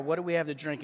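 low, subdued retelling of a complaint; understated, dropped-down quote; retold cry. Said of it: "They're crying out".

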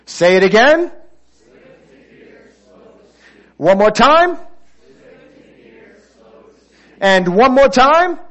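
loud, high call with rising end; rallying the congregation. Real, not acted. Preacher wants everybody to say it with him, rallying shout. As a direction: urge the crowd to repeat, loud and lifted.

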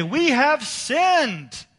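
very high, slow, wailing "We have sinned"; drawn-out plea. Retold or imitated. Israel crying out to God, high and stretched. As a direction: wail it like a guilty people begging. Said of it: "Then they would say".

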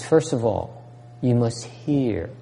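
ordinary talk, nothing marked

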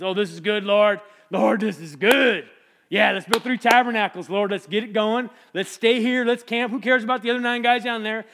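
loud, high, gushing enthusiasm; eager outburst. Acted. Peter begging the Lord to stay on the mountain, excited. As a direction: pile on suggestions excitedly.

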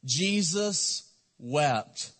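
very slow, each word weighed; slow and solemn. Shown as a demonstration. Pastor reciting the verse to his church, very slow. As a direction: let the verse sink in slowly. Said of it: "It's two words".

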